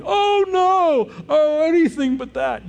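hammed-up cry of dread from the children; kids protesting. Kids groaning at family meeting.

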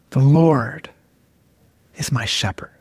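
low, savoring scripture reading with a long pause; really slow rereading. Shown as a demonstration. Preacher savoring a psalm verse, really slow rereading. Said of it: "really slowly".

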